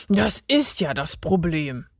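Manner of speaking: mildly huffed reply; huffing. A narrator's reading. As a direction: say it with a slight huff. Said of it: "schnaufte Gerd". (leicht geschnauft)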